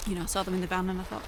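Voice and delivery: talking through a mouthful; words round a bite of food; mouth full. Real, not acted. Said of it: "I've got pillows in my mouth".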